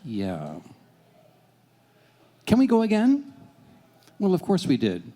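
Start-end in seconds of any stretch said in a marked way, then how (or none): 2.5-3.3 put-on high, bright child asking to go again; eager little girl voice. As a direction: squeak it like an excited kid. Little daughter begging after ride.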